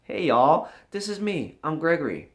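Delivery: normal talking voice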